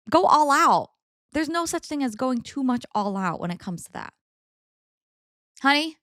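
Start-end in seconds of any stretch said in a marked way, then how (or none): 0.1-0.9 loud push on "go"; go all out. Real, not acted. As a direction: punch it out louder. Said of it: "Yeah, like".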